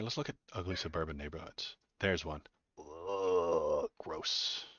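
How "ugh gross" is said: disgusted groan. Acted. Mock disgust at suburban photos.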